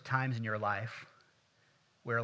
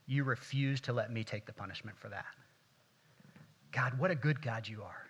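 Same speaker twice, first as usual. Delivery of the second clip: prayer. Acted. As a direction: pray gently to God. Leads a prayer.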